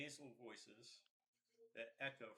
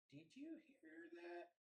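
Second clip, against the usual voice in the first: nervous squeaky goblin; 4 dB quieter than usual. Acted. Nervous goblin hears something.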